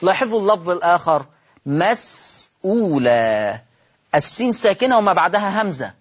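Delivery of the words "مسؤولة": مسؤولة said very slowly with the hamza fully pronounced, as Hafs reads it; with full hamza. Shown as a demonstration. Teacher demonstrating tahqiq on the word مسؤولة. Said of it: "فقرأت بالتحقيق كحفص".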